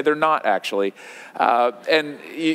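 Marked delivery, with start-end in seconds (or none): 0.0-0.9 low, unbothered deadpan denial. Retold or imitated. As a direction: imitate a child's matter-of-fact contradiction, pitched low. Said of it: "he'll look at you deadpan".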